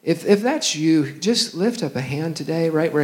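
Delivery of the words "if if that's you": ordinary talk, nothing marked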